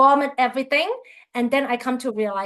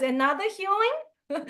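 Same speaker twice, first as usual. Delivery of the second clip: mock disbelief; 7 semitones higher, 5 dB quieter than usual. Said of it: "I'm like".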